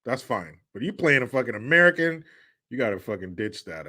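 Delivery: usual speaking voice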